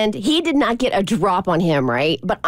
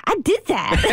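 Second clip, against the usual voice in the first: put-on higher, laughing toddler voice; higher proud toddler reply, laughing; 6 semitones higher than usual. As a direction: own it proudly like a little kid. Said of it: "He goes".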